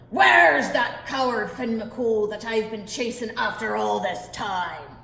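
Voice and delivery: loud, slow giant roar; bellowing giant. Acted. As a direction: boom it out like a furious giant. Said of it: "bellowed the giant at the door".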